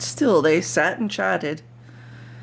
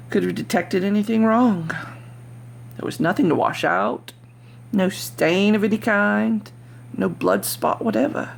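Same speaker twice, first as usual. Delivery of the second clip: ordinary delivery, nothing marked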